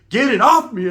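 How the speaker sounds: high, loud disgusted shout; loud disgusted cry. Retold or imitated. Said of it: "I said".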